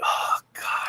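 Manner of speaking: hushed whisper; whispered dismay. Retold or imitated. Mom finding kittens in the room, whispered oh God. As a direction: breathe it out in dismay.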